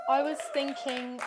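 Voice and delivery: ordinary talk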